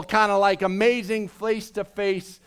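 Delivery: ordinary talk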